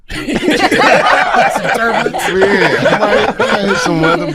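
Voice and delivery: laughing through the punchline. Real, not acted. Comic laughing at his own pilot line. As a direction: giggle out the words as he repeats the joke.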